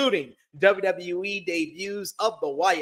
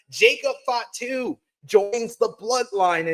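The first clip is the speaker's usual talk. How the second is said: ordinary talk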